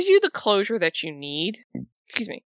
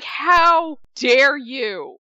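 slow, drawn-out, louder mock outrage; 5 semitones higher, 4 dB louder, much slower than usual. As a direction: stretch it out like you're scandalized. Reaction to the hologram.

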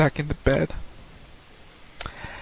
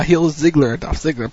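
ordinary talk, nothing marked

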